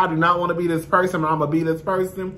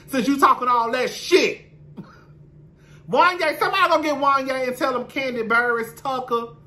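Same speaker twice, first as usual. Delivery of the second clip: calling out; 8 semitones higher than usual. Acted. Calling out to Wanya.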